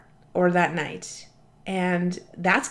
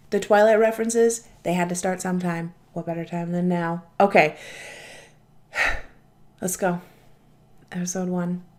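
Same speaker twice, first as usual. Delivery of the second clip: normal talking voice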